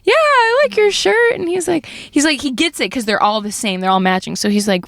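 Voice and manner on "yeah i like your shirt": high, drawn-out reply; mock-enthusiastic approval; imitated humoring grandpa. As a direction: humor the kids in a slow singsong. Voicing dad humoring the kids.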